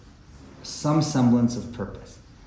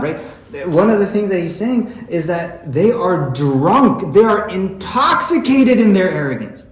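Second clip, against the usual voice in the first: fervent preaching; 7 semitones higher, 6 dB louder than usual. Acted. Impassioned preaching on the poem.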